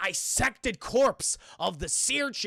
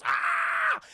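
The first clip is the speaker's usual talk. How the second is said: very high, sustained comic scream; mock death scream; 4 dB louder than usual. Seer getting stabbed in the face, mock death scream.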